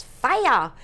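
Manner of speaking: put-on loud, high childlike Fiah with no r; New England accent. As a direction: shout Fiah like an excited little boy. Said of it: "he's even got that New England accent".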